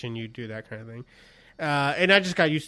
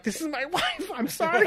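high, laughing fake-sorry announcement; mock apology; 12 semitones higher than usual. Husband answering her across the dance floor, high mock apology. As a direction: pitch it way up, laughing through the apology. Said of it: "And I go like".

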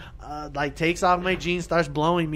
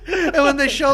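laughing; 12 semitones higher than usual. Real, not acted. Laughing, high.

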